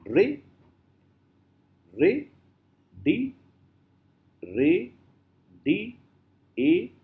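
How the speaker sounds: "Re, di, a" as separate rising syllables; long pauses between "re", "di" and "a"; isolated vowel sounds. Shown as a demonstration. Teacher showing vowel sounds, isolated syllables. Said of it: "So, uh, vowel sounds".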